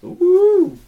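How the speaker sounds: very high, loud delighted hoot; excited squeal. Real, not acted. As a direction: squeal with joy at the surprise. Surprised by gift.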